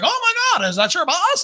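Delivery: high, gushing surprise; overjoyed fan of the bus; mock excited stranger. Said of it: "tell his voice in a way of how he made me feel".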